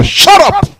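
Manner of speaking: high-pitched shouted rebuke; shouting at pastor. Acted. Congregant telling the pastor off, shouting. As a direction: cut the preacher off with a high cry. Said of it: "shouting at their pastors".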